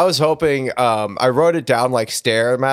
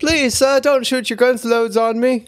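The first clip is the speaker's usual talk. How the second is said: put-on high, pleading child voice; pleading little boy; 12 semitones higher than usual. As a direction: beg like a scared little boy. Playing pleading Old West boy.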